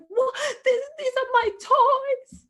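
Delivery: high, loud, tearful wail; crying child. Retold or imitated. Son cries out that the toys are his, high and tearful. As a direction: protest through angry tears. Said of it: "he was like".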